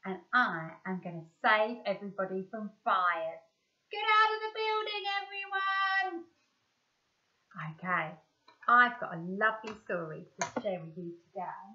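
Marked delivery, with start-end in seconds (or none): 3.9-6.2 high, stretched firefighter shout; firefighter call. Pretend. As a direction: call everyone out like a brave firefighter. Playing firefighter saving everyone.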